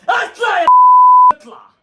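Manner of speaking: high, loud shout at the screen; angry yell. Real, not acted. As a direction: bark it at the player. Yelling at the player. (يصرخ في اللاعب بغضب)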